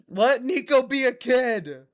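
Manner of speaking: high, loud, slow shouted demand; slow drawn-out shout at the game; drawn-out yell. Real, not acted. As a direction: stretch it out and yell. Repeated louder, slower.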